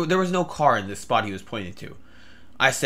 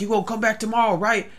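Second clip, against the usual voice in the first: higher-pitched crazy stranger; drugged stranger; 10 semitones higher than usual. Retold or imitated. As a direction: play the man on drugs, pitched up. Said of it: "either he was crazy or he was on".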